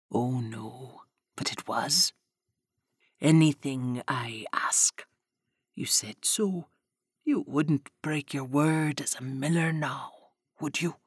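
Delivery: sinister Spinner holding the miller's daughter to her word; sly Spinner. Acted. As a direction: purr the threat slowly.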